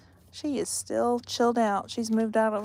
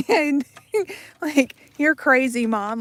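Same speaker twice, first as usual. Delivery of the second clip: louder, laughing through the dog's imagined words; 6 semitones higher, 7 dB louder than usual. Retold or imitated. Voicing the dog mocking mom, laughing. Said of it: "she'd be looking at me like".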